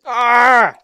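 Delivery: loud, high yell; frustrated scream. Real, not acted. Gamer dead again, frustrated scream. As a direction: yell out at being killed. Dies in the game.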